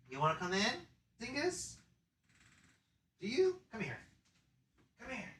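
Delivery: high, soft coaxing voice to a cat; calling Dingus the cat inside in a high hush. Real, not acted.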